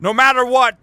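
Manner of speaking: ordinary talk, nothing marked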